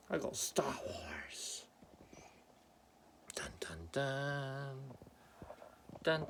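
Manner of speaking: slow whispered Star Wars reveal; dramatic hushed build-up. Pretend. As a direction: whisper it like a secret treasure.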